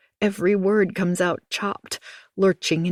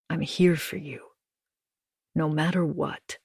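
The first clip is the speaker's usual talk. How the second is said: softer, heavier, full of pity; 2 semitones lower, 3 dB quieter than usual. A narrator's reading. Husband soothes his frightened wife, softer with pity. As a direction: comfort her gently, a bit softer. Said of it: "softer, but heavier, weighted with pity".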